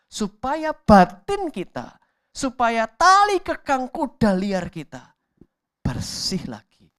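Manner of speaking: preaching at full force, slowing to hammer the words; fiery preaching. Acted.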